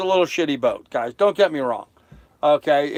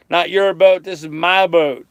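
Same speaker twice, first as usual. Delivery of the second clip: "your" and "my" punched in contrast, louder; your versus my; 4 dB louder than usual. Shown as a demonstration. Telling the chat whose boat it is, contrasting "your" and "my". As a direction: play "your" against "my". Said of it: "I said my boat".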